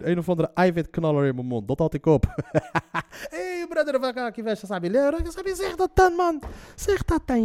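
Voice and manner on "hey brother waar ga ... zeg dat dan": high-pitched mock pally cry; hammed-up chummy captor. Captor suddenly friendly with his prisoner, hammed-up chummy voice. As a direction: overplay a captor greeting a long-lost brother.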